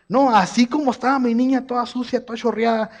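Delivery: normal talking voice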